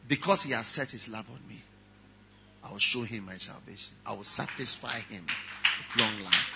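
low, soft and tender; soft scripture reading. Acted. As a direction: read God's promise gently. Quoting God's promise softly.

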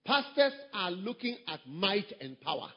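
ordinary delivery

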